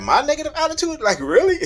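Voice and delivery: can't believe she said that; incredulous. Retold or imitated. Retold quarrel, wife blames him.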